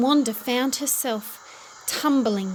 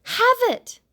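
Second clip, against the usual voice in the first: much higher, loud yelled give-in; yelling; 13 semitones higher, 7 dB louder than usual. A narrator's reading. Shopkeeper yelling as he gives Wanda the kite, loud. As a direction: fling the words out in angry surrender. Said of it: "yelled the shopkeeper".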